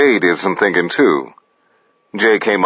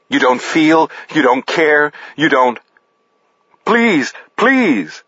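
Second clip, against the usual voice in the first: desperate pleading; 8 semitones higher than usual. A narrator's reading. Gunpoint accusation and pleading.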